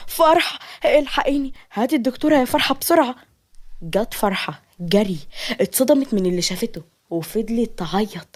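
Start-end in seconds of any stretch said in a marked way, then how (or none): 0.1-3.1 high, urgent cry for help; screaming for help. A narrator's reading. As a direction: call out in panic, hurrying at the end. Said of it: "صرخت نادية". (استغاثة بصراخ)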